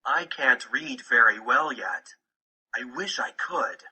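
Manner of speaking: normal talking voice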